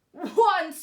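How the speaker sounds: a loud, croaking cry. A narrator's reading. Lorax cries out at the Once-ler, loud croak. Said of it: "he cried with a crufulous croak".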